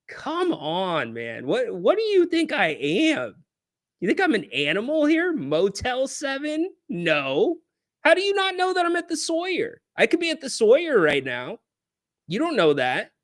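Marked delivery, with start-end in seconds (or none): 0.1-11.6 high, indignant mock offense; mock outrage. Real, not acted. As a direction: act insulted by the cheap motel guess. Mock outrage at hotel guesses.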